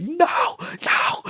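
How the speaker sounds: mock panicked cry. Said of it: "he's like".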